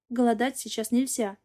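plain reading voice

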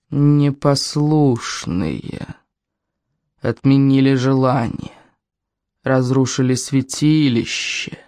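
very slow, low spirit voice; slow, drawn-out accusation; ominous well spirit. Acted. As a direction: draw out each word like an ancient spirit passing judgement. Offended well spirit accuses them.

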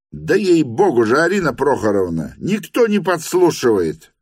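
exasperated protest. A narrator's reading. Lyamshin jumps up from the piano, high exasperated protest. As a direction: swear it's true, exasperated and high.

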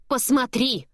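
high insistent cry; exclaiming. Acted. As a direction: urge him, voice jumping up. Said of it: "восклицает Диметра".

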